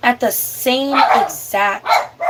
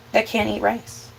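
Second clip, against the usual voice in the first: normal talking voice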